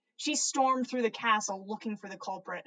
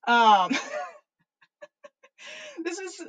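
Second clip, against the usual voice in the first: embarrassed laughter; 8 semitones higher, 4 dB louder than usual. Real, not acted. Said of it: "on purpose".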